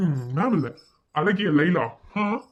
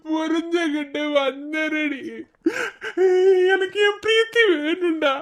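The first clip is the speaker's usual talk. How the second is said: sobbing. Acted. Man grieving to his friend over missing Preethi and baby, sobbing. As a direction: wail and plead, breaking into sobs between phrases. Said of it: "அழாத".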